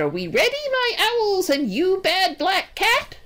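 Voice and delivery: rally your creatures in a high witch voice; old witch. A narrator's reading. Old witch rallies her creatures.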